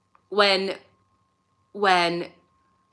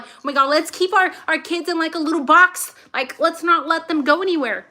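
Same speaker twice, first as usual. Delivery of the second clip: higher, louder mock-fussy voice; mocking overprotective people; 8 semitones higher, 4 dB louder than usual. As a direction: mimic a panicky overprotective parent. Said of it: "They're like".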